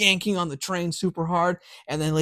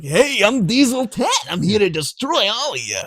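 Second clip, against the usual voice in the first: high, loud Bronx thug growl, slowed down; Bronx tough guy; 7 semitones higher, 6 dB louder than usual. Retold or imitated. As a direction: threaten everyone like a Bronx mobster. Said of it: "this Bronx dude".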